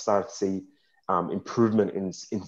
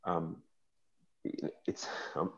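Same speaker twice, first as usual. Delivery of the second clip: choked up; 3 semitones lower, 12 dB quieter, slower than usual. Real, not acted. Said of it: "I get a bit emotional".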